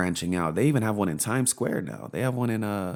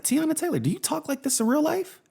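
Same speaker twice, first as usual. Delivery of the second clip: incredulous question. Retold or imitated. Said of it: "I was like".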